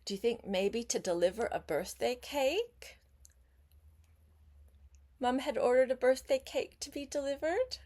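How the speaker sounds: usual speaking voice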